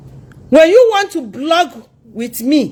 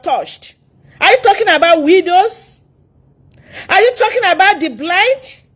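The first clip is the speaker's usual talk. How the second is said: higher-pitched defensive challenge; indignant challenge; 6 semitones higher than usual. Real, not acted. Woman challenging critics over her charity work, indignantly. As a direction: demand answers from your accusers.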